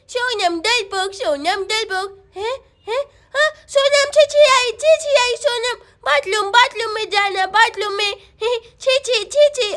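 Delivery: put-on repetitive babyish little-boy voice; babbling toddler. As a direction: chant it like a tiny child. (छोटे बच्चे की तोतली बोली)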